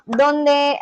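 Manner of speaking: normal talking voice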